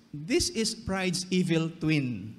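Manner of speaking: ordinary talk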